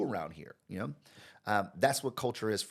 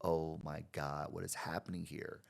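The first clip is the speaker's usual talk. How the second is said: dismayed inner voice; 3 semitones lower, 7 dB quieter than usual. Retold or imitated. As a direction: sink into your chair, appalled.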